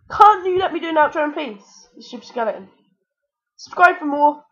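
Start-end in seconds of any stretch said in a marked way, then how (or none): 0.1-2.7 loud, higher-pitched complaint; indignant raised-voice protest; exasperated scolding. Real, not acted. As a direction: snap at the attacker in exasperation. Scolds skeleton interrupting outro.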